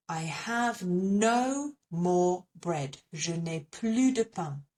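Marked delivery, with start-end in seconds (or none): 3.1-4.6 French plus, silent S; plus without the S. Shown as a demonstration. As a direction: say je n'ai plu de pain, no S. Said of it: "we do not pronounce the S".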